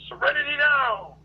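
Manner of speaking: high, loud sitcom-father scream; screamed catchphrase. Acted. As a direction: yell it out high, drawing out the last word. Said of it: "he'd go around screaming".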